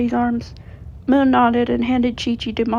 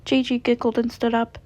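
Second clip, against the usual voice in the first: ordinary delivery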